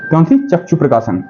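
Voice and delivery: usual speaking voice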